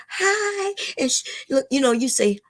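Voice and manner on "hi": friendly hi. Shown as a demonstration. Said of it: "Y'all should be getting together in these little areas and say".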